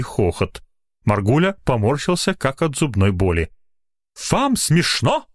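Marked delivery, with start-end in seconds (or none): 4.3-5.3 high-pitched indignant question; angry yelling. A narrator's reading. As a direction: demand it in an outraged high voice. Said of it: "перешёл он на крик".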